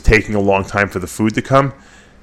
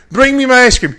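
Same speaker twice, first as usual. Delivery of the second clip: high, loud mock Nasser tantrum; impatient accented bodybuilder yelling; Nasser's outburst; 13 semitones higher, 4 dB louder than usual. Nasser demands ice cream.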